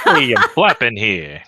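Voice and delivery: loud, slow New Yorker holler; mock New York street yell; New York Jersey accent. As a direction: shout it like a hammed-up New Yorker. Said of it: "Jersey kinda accents".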